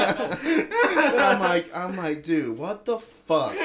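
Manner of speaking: high, laughing through a drawn-out reaction; slow, incredulous words through laughter; real laughing. Real, not acted. Laughing at the absurd punchline.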